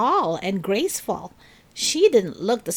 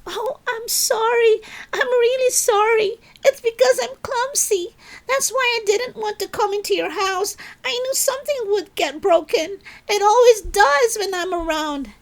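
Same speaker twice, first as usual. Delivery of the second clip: put-on much higher, louder little girl voice, pleading; a clumsy girl blurting out her apology; upset girl apologizing; 14 semitones higher, 4 dB louder than usual. A narrator's reading. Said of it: "Then Trudy said".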